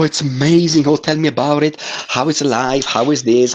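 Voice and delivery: usual speaking voice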